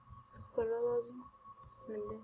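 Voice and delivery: soft whispered prayer; hushed frightened prayer. Real, not acted. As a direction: breathe the prayer under your breath. Scared player murmuring prayer.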